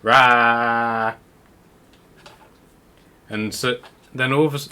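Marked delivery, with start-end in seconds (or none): 0.0-1.1 long, loud open-mouthed "raaa". Acted. Someone tells Padwin to open his mouth, long loud "ra". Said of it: "say 'Ra.'".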